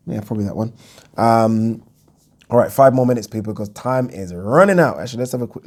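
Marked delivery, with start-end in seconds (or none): none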